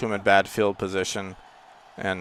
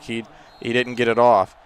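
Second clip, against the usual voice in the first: ordinary talk, nothing marked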